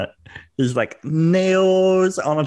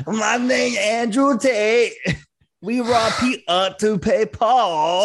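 higher, hammed-up British Andrew Tate voice; mock British accent; 6 semitones higher than usual. Taunting the hosts with a bad British impression of Tate. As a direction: butcher the British accent for laughs. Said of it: "Now you sound like a fucked up version".

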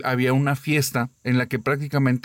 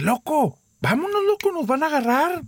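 very high, urgent cry; urgent calling out; 14 semitones higher than usual. Retold or imitated. Girl urging her frozen boyfriend to drive off, high urgent cry. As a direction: hurry him, voice leaping up.

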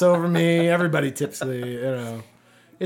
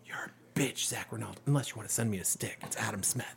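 unvoiced whisper aimed at a rival; sneering whisper into the mic; whispered taunt; 5 semitones lower, 13 dB quieter than usual. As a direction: hiss the insult under your breath. Whispered taunt to absent player.

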